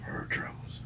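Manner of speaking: soft, barely voiced whisper; whispered confession. Real, not acted. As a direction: breathe the apology out with no voice. Confessing the killing to police.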